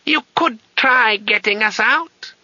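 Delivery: put-on very high, slow incredulous old man; old wizard voice. A narrator's reading. Old wizard doubting the name through the door, high and slow. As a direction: stretch the name out in aged disbelief.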